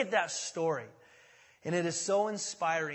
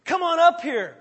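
loud, higher taunting call; 9 semitones higher, 11 dB louder than usual. Acted. Philistines taunting the pair, loud higher call. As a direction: bait them to climb. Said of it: "Basically taunting them".

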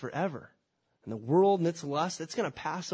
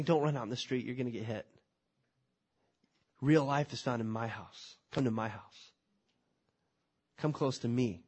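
tender fatherly plea; 5 semitones lower than usual. Acted. God pleading like a loving father.

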